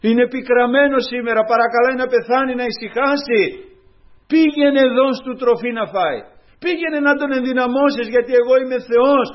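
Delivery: loud, high commanding voice of God; God commanding the angel. Retold or imitated. God sending his angel to Elijah, loud and commanding. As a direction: send the angel with authority. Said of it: "Έστειλε τον άγγελό του".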